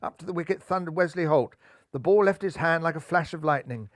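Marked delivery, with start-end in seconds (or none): none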